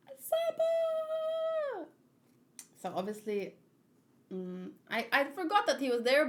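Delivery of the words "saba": a sudden very high, delighted cry; excited shout. Real, not acted. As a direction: burst out with joy at the name. Excited at seeing Sabo.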